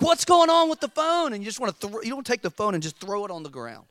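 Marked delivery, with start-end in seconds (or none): 0.0-1.3 high, loud exasperated outburst; mock frustration. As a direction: act out losing patience with a phone. Acted frustration at failing phone.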